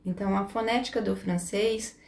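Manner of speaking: ordinary talk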